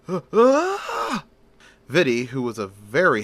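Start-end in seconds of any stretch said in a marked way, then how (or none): 0.1-1.2 high, loud terrified scream of a man yanked into the air. Acted. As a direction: shriek as you're snatched off your feet. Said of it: "as he screamed".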